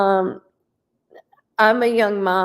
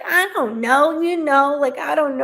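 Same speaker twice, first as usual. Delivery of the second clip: put-on higher, louder teen shrug; mock the girl's unsure answer, pitched up; imitating daughter; 7 semitones higher, 3 dB louder than usual. Daughter answering her mom, mock girlish and higher. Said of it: "She's like".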